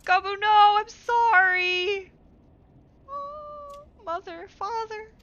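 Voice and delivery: high, loud, slow tearful apology; grieving child voice. Acted. Gabu mourning mother and father, tearful. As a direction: let it break into grief.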